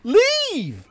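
real exasperated shout at the crowd; angry shout. Real, not acted. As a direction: bellow at them to leave. NPCs still won't leave.

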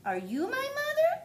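very high hopeful question; baby bird voice. Acted. Baby bird asks the dog, very high. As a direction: squeak the question up. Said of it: "he said to the dog".

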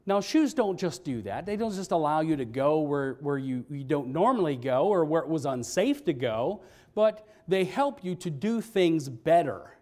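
usual speaking voice

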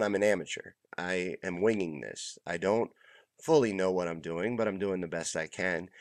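ordinary delivery

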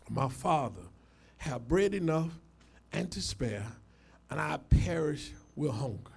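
soft, low, humbled recitation; hushed, reverent Bible reading; solemn scripture reading. Real, not acted. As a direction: read it low and subdued, like a confession. Reads scripture aloud.

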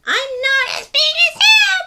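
very high, loud squeaky tiny dinosaur; a tiny creature proudly calling out; little creature. Acted. Reader voicing little scuttling dinosaur answering Pipkin. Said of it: "said the dinosaurs".

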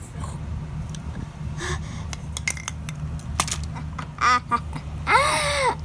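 high, breathy witch cackle; evil cackle. Acted. Witch gloats after poisoning.

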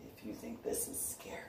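hushed eerie threat; whispered you-think-this-is-scary taunt. Retold or imitated. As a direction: whisper it as a menace.

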